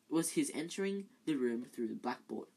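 plain reading voice

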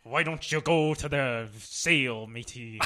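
put-on Irish accent that slides into pirate; a shaky Irish voice turning pirate; clumsy practiced accent. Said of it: "Do, do an Irish accent".

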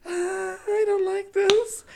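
a nervous little squeak of worry; mock nervous. Retelling her nerves at the police blockade, mock timid. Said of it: "I was just like".

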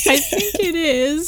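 very high, teasing lilt rising at the end; mock-coy high-pitched answer; playful squeak. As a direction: squeak it back playfully, half laughing. Playfully repeats answer to doubter.

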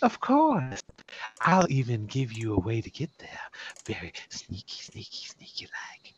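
sly, hushed helper voice; sneaky conspirator character. Acted. Game master voices sneaky helper.

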